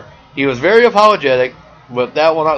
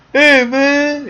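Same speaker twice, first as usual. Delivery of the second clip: put-on much higher slurry drunk voice; drunk guy; 12 semitones higher, 3 dB louder than usual. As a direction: play a sloppy drunk calling out. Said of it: "He's drunk, by the way".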